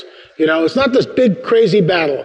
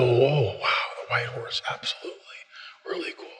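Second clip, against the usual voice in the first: hushed wonder, sinking into a whisper; awed whisper; 5 semitones lower, 12 dB quieter than usual. Retold or imitated. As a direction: marvel under your breath, trailing into near silence. Awed reply to the Holy Spirit.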